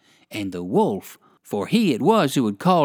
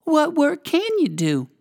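high squeaky mouse lady question; a tiny mouse lady, curious; 12 semitones higher than usual. A narrator's reading. Mouse lady asks the rabbit, high and sharp. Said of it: "asked the mouse lady".

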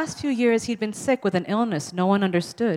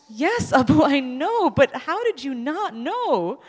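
laughing tease; 7 semitones higher, 3 dB louder, slower than usual. Retold or imitated. Teasing daughter laughing.

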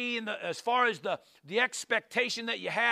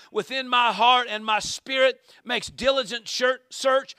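fervent scripture reading; 3 semitones higher, 5 dB louder than usual. Acted. Preacher reading Psalm seventy-seven to the church, fervent. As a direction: read the psalm louder, with preacher's fire. Reads psalm with rising force.

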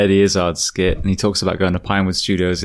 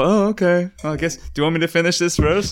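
playing a surprised carpenter; 10 semitones higher than usual. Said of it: "I was there just banging nails and like".

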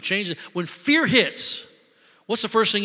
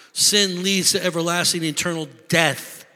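usual speaking voice

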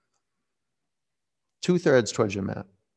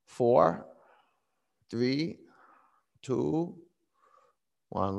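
ordinary talk, nothing marked

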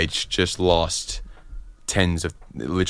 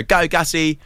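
cheering call; 8 semitones higher, slower than usual. Retold or imitated. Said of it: "sometimes we go".

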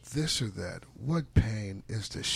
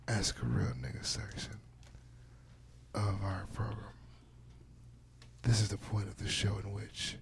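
announcer voice; 5 dB quieter than usual. Acted. Segment intro, partly whispered.

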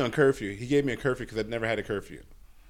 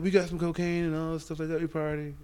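put-on pitched up, chummy older dude with a soft invite; old yacht guy; 6 semitones higher, 3 dB quieter than usual. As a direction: play the old man who keeps insisting, brother. Imitating old guy offering drugs.

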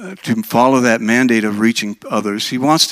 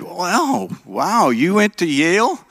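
impressed surprise; 7 semitones higher, slower than usual. Retold or imitated. Impressed interviewer in joke.

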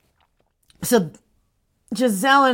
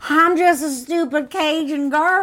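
high, slow, hammed-up Cajun drawl; mocking impression in a terrible Cajun accent; horrible Cajun accent; 7 semitones higher than usual. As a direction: do a badly faked Cajun girl. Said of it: "like, really horrible accent".

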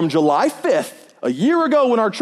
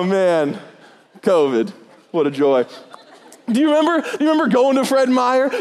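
ordinary talk

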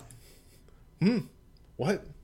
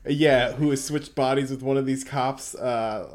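ordinary talk

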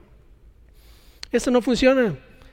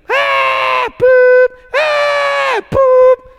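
high, loud shouted war cries; battle shouts; 5 dB louder, much slower than usual. Retold or imitated. Preacher acting the army's battle cry, high loud shouts. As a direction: yell it like soldiers charging.

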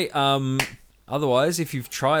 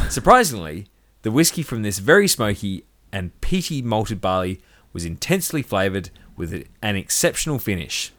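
ordinary talk

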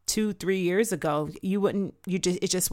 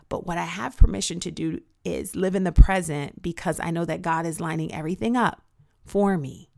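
ordinary talk, nothing marked

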